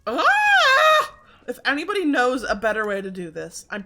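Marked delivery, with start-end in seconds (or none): 0.1-1.1 shrill, loud high scream; a sharp loud shriek of fright; startled scream. Real, not acted. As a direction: scream at the sudden scare. Sudden danger in game.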